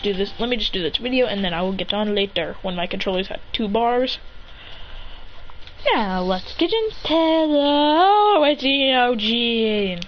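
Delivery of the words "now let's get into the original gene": slow, stretched-out kickoff; drawn-out intro. Real, not acted. Narrator launching the original story, drawn-out intro. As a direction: stretch the words out slowly.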